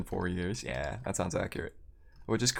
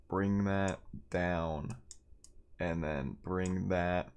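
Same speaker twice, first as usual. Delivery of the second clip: slow muttering; 5 dB quieter, much slower than usual. Real, not acted. Fiddling with settings.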